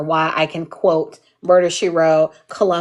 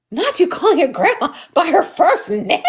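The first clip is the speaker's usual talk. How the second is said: higher, incredulous and laughing; mock scandalized; 9 semitones higher than usual. Imitating people hearing her call grandma by name, scandalized. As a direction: act shocked but amused.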